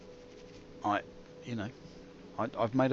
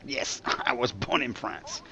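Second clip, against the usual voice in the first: put-on slow French accent with a laugh; 2 semitones higher, slower than usual. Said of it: "now you sound a little bit French".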